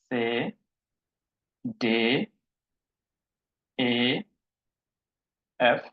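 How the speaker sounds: German letter names one by one, long pauses between; slow, spaced-out alphabet drill; model alphabet reading. Shown as a demonstration. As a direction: recite each letter separately for learners to follow. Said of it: "আমি একবার পড়বো".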